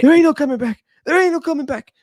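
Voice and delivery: high strained terrified wail; mock terrified T-Bird. T-Bird faces the returned Crow, mock terrified wail. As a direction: plead in panic. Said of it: "He's like".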